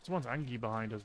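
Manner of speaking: ordinary talk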